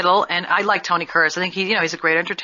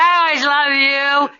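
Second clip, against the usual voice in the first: put-on drunk slurred voice; 7 semitones higher, 3 dB louder, much slower than usual. Drunk dialing slurred declaration.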